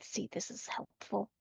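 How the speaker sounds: very soft aside; hushed in-character remark; under the breath. Acted. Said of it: "also whisper under my breath".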